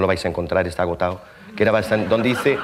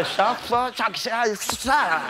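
high teasing gibberish; mock jungle language; 13 semitones higher, much slower than usual. Mocking the rival in his own tongue, high gibberish. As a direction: fire back the nonsense words, gloating.

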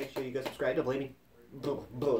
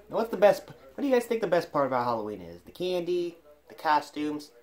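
ordinary delivery

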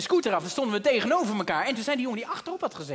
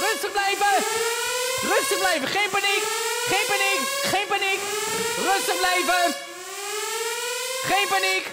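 panicked calling out; 9 semitones higher than usual. Acted. Calling to everyone over the alarm, panicked shouting. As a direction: yell for calm while sounding panicked.

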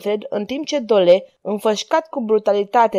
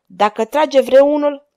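slow, loud, raised-pitch warning; thundering threat; 5 semitones higher, 6 dB louder, slower than usual. A narrator's reading. Manfred holding a dagger over the magistrate, thundering. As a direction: thunder it out slowly and high. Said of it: "tună el".

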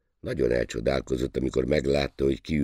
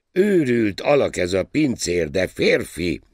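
high, loud exclamation; captain's loud, high-pitched remark; ship's captain; 8 semitones higher, 7 dB louder than usual. A narrator's reading. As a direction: call it out emphatically as the captain. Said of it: "jegyezte meg a kapitány".

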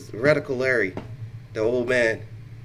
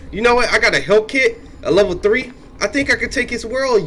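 loud, high and pumped; psyched up gamer; hyped up; 8 semitones higher, 4 dB louder than usual. Real, not acted. Pumped up before risky move.

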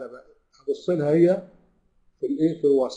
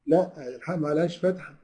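ordinary talk, nothing marked

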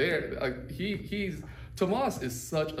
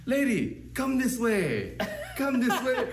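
much higher, slow coaxing call; suave invitation; 12 semitones higher, 4 dB louder, slower than usual. Retold or imitated. Imitating Tomas inviting the lady to come his way. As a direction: invite her in like a smooth gentleman. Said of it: "You say".

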